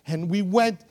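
high, loud, driving testimony; impassioned preaching. Acted. Preacher telling how they led them to Jesus, impassioned preaching. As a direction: proclaim it at full force.

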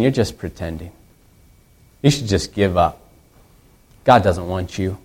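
low, soft inner voice of doubt; hushed, low-pitched inner accuser; little voice in the head. Acted. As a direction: drop low and quiet, like a discouraging thought. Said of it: "that little voice in your head".

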